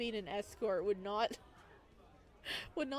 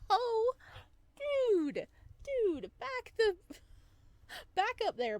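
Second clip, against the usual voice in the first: much higher and louder, shocked cry; shocked outburst; 13 semitones higher, 6 dB louder, slower than usual. Acted. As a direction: yell out in startled disbelief. Friend suddenly gets sassy, shocked.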